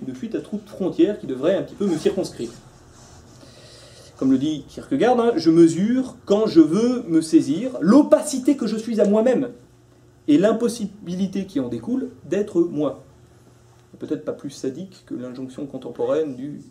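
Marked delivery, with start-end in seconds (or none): 5.4-13.0 slow, high, solemn declamation; declaimed quotation. Real, not acted. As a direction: stretch each phrase like a weighty quotation.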